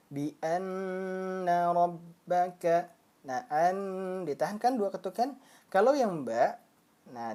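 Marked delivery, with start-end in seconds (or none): 0.1-2.9 Bi anna rabbaka slow, nn hummed two beats; held gunnah. Shown as a demonstration. Teacher modeling the verse. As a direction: hold the nn in anna. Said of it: "Jadi seperti apa".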